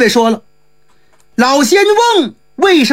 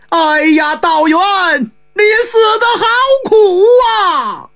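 wailing shout, pitch rising high on 你死得好苦啊; crying out loud; 6 semitones higher than usual. A narrator's reading. Old immortal mourning his dead friend Chu Daoyuan, wailing shout. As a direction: cry it out from outside, half sobbing, half yelling. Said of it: "就听外边有人大叫一声".

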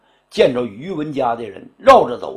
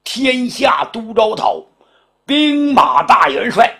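emperor's decree; 5 semitones higher than usual. Acted. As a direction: hand down the appointment like a new emperor. Emperor appoints his marshal.

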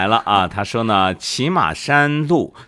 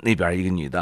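normal talking voice

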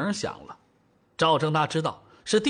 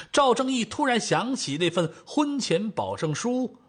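ordinary delivery

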